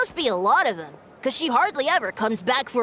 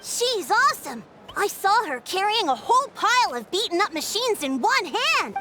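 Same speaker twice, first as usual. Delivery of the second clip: put-on higher, excited kid praise; gushing; 8 semitones higher than usual. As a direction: rave about her like a fan. Kid gushing about hero.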